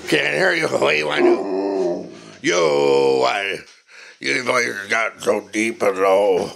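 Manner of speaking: ordinary talk, nothing marked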